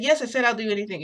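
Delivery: high, loud and fast; flustered insistence. Retold or imitated. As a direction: rush the words out, flustered. Flustered eager reply in scene.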